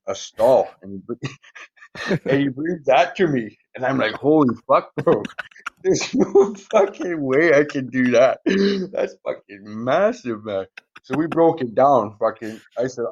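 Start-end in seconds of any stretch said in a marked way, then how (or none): 4.1-10.7 stunned disbelief. Retold or imitated. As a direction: replay the shock at the size of it, drawn out. Reacting to a huge stack of coins.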